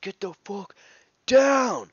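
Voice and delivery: high, loud angry yell, last word exploding; imitating brother's yelling. Older brother yelling at kid stuck in a tree, mock furious yell. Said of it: "starts yelling at him".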